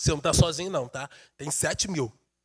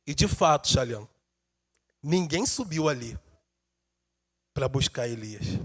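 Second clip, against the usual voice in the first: soft intimate preaching; 8 semitones lower, 5 dB quieter than usual. Acted. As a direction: draw them in gently.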